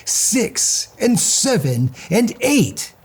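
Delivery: very slow, drawn-out counting; slow suspense count. A narrator's reading. Narrator counting the lit candles, slow and tense. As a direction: let each number hang in the air.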